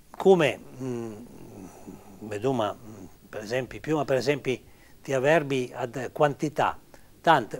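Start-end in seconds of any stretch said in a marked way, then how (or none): none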